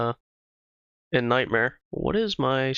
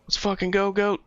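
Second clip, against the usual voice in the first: excited cheer; 7 semitones higher than usual. Real, not acted. Cheering a raider.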